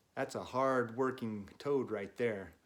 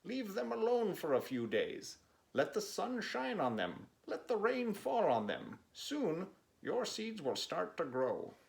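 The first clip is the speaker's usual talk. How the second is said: higher, kindly Frog explaining; Frog's voice, advising; 8 semitones higher than usual. Acted. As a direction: Frog calmly giving advice, pitched up. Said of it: "said Frog".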